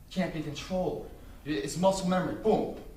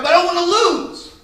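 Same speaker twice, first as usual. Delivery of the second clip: hammed-up son yell; 16 dB louder than usual. Nervous young wrestler answering his dad, hammed-up yell. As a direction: wail it back in mock desperation.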